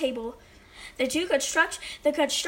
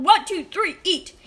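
raised, higher starting count; judge yelling; 4 semitones higher, 4 dB louder than usual. A narrator's reading. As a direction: call the start of a race. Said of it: "He yelled".

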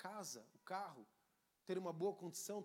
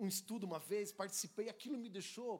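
ordinary talk

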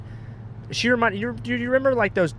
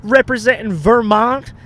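high, loud, drawn-out hype; mock an overexcited promo host; imitated hype-video host; 8 semitones higher, 6 dB louder, slower than usual. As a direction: shout out to the camera, stretching the words. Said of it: "trying to make a hype video".

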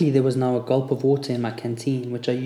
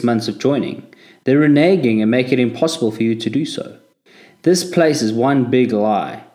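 indignant; 7 dB louder than usual. A narrator's reading. Angry at the Legion's betrayal.

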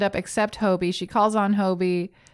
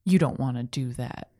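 low, soft, deadly serious warning; a mock intense low warning; intense Craig; 8 semitones lower, 4 dB quieter than usual. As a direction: warn him off gravely. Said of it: "Craig's like".

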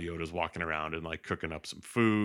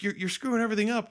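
fast, high-pitched mock scolding; exasperated scolding; 3 dB louder, faster than usual. Luke separating the two, scolding in exasperation. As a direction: rattle it out in exasperation.

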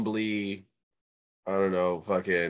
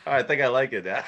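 amused laughing; 4 semitones higher, faster than usual. Real, not acted. Cracking up over the thumb insult.

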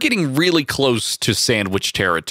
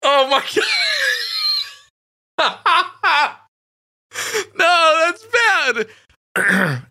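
crying with laughter; much slower than usual. Real, not acted.

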